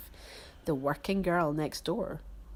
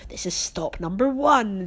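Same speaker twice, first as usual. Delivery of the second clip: louder hiss of a villain; hissed announcement of his plan; mock-sinister hiss; hissing Grinch; 3 semitones higher, 8 dB louder than usual. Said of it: "the old Grinchy Claus hissed".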